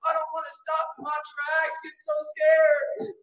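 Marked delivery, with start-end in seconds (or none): none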